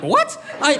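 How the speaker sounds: a sudden high shocked "What?"; shocked squeak. Retold or imitated. Stunned boy squeaks at the guys' news. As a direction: yelp it in total disbelief. Hearing Sarah remembers a kiss.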